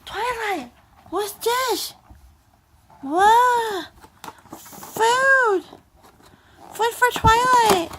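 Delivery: high, loud, thrilled pet talk; delighted high squeal about food; excited baby talk. Acted. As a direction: cheer over food for the pet.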